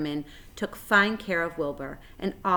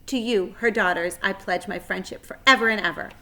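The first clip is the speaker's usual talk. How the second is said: a lightly formal, louder address; short speech; 3 semitones higher, 6 dB louder than usual. Acted. Wilbur welcoming the three spiders, solemn speech. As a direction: deliver it as a solemn little speech. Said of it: "make a short speech".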